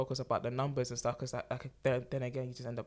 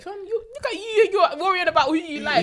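loud, very high-pitched mock exclamation; imitated brother's exclamation; 10 dB louder than usual. Sister voices her brother brushing off her worry, loud high-pitched imitation. Voicing brother's reassuring reaction.

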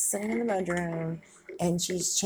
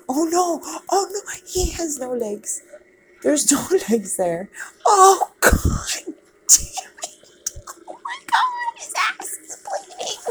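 horrified shrieks; 12 semitones higher, 7 dB louder than usual. Real, not acted. Gore in horror movie.

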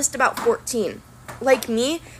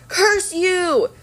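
Thorne's high snarling yell; villain cursing his attackers; yelled curse; 8 semitones higher than usual. A narrator's reading. Thorne cursing the hidden archers, yelling. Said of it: "Thorne yelled".

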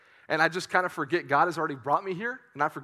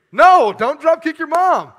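much higher, loud, slow shout; mock shout; 10 semitones higher, 14 dB louder, slower than usual. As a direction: yell it like a hammed-up warning. Louder repeat, shouting.

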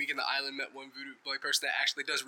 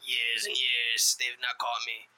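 slow mysterious voice; 3 semitones lower, much slower than usual. Pretend. Worm boasting, mysterious.

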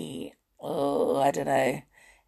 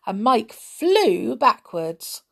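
ordinary delivery, nothing marked

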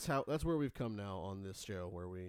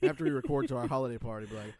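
normal talking voice

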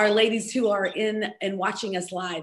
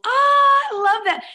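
delighted, high and slow; excited; 13 semitones higher, 8 dB louder, slower than usual. Real, not acted. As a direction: gush over it, way up high. Delighted by a comment.

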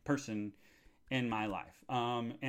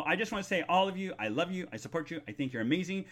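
usual speaking voice

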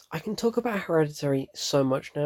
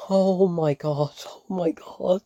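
drawn-out, higher-pitched horrified exclamation; re-enacted panic; 4 semitones higher, 3 dB louder, slower than usual. Viewer reliving the scene's reveal, panicked. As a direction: relive the horror, stretching the words. Voicing own panic while watching.

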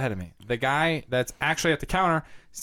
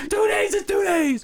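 high-pitched hammed-up yell; mock shouted insistence. Amazon bosses insisting on delivery time, mock shouting. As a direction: insist like an outraged boss, far above your usual pitch.

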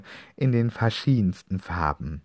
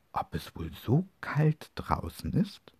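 ordinary delivery

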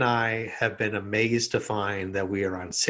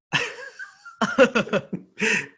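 long high laugh; helpless laughter; 11 semitones higher, 3 dB louder than usual. Real, not acted. Laughing after joke.

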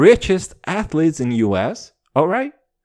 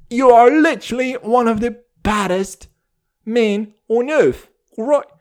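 loud, high mock-British scolding; British accent; 6 semitones higher, 5 dB louder than usual. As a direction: berate him in a hammed-up British accent. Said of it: "Dude, I'm so British".

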